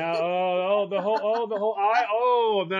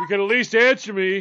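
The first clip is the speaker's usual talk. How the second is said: demanding reply; 4 semitones higher, 10 dB louder than usual. Retold or imitated. Imitates man demanding a reply.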